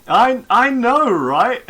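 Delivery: excited. Real, not acted. Teased about spaceship combat, excited.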